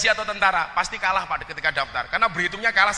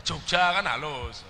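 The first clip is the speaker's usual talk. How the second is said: ordinary talk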